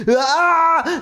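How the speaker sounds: very high, loud scream; boy screaming as time warps; startled scream. A narrator's reading. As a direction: cry out in shock. Joe during warp.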